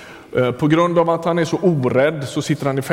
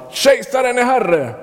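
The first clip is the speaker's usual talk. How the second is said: sharply high-pitched hailing call; mock call-out; 9 semitones higher than usual. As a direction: call across the street to hail the emperor. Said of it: "någon ropar". (högt, ljust utrop på gatan)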